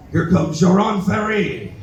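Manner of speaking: slow, hammed-up monster entrance; scary giant announcement.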